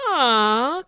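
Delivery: loud shocked Ah; crowd outcry. A narrator's reading. Crowd hearing the death sentence, loud shocked cry. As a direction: cry out in horror. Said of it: "cried the crowd".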